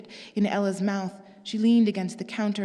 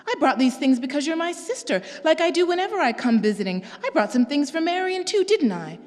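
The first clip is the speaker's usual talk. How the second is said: higher, louder hurt sister; pleading and wounded, pitched up; Pearl's voice; 7 semitones higher, 5 dB louder than usual. Acted. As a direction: sister defending her gifts, higher and wounded.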